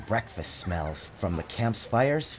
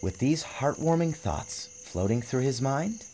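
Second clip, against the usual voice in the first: soft, fading; 6 dB quieter than usual. A narrator's reading. Drifting to sleep.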